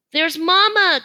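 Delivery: high, loud joyful cry; excited cry. A narrator's reading. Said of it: "Kudzu cries".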